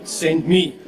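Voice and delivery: ordinary talk, nothing marked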